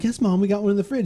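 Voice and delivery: put-on kid voice. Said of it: "the other kid's like".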